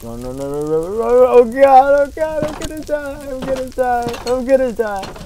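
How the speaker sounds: high, loud shrieking panic building to I'm gonna die; panicked screaming. Real, not acted. Player fleeing lava, screaming in panic. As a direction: scream like the lava is right behind you.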